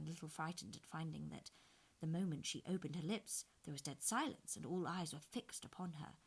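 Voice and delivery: usual speaking voice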